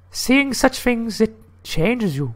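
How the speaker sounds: slow, high falsetto lady; high-pitched, solemn put-on woman. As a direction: play the lady, high and drawn out. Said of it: "I get to do the lady voice".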